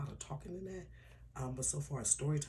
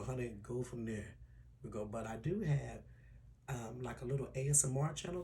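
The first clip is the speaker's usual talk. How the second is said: usual speaking voice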